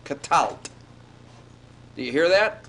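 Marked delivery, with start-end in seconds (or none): 0.0-0.7 "katalt" ending directly in the lt cluster; katalt in two syllables. Shown as a demonstration. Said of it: "but as two syllables".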